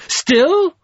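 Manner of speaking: high, loud gleeful cry; gleeful shout. A narrator's reading. Dark lord mocking Covenant, high loud gleeful shout. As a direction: crow it out in delight. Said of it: "the despiser shouted with glee".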